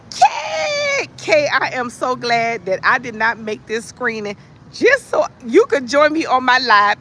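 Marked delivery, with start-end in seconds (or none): 0.1-1.1 high, shouted hello to a newcomer; excited greeting. Real, not acted. Welcoming a friend who joined her live, excited shout. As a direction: call her name out with delight.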